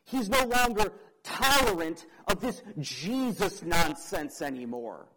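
usual speaking voice

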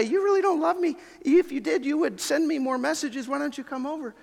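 high, whiny, accusing; guilt-tripping parent. Acted. Preacher voices a parent guilt-tripping their adult children. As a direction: lay the guilt on thick like a hurt parent.

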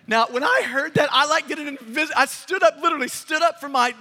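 loud, high thrilled retelling; can't stay in the chair; excited. Real, not acted. Preacher to congregation, thrilled and loud.

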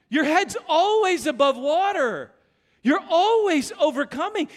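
slow, loud, emphatic preaching; preaching at full force. Acted. Pastor assuring the congregation, slow and loud. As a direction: lean in and proclaim it.

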